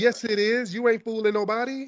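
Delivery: high-pitched, argumentative rebuttal; insistent contradiction. Real, not acted. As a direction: pitch it up and refuse the denial. Host disputes caller's accent denial.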